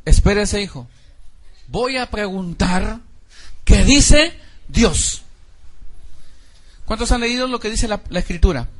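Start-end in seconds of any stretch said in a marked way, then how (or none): 0.1-5.2 slow, emphatic voice of a father pausing to consult God; firm father. Retold or imitated. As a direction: make the son wait, then say it slowly and loud. Father answering son.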